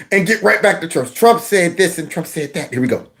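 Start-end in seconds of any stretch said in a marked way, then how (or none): none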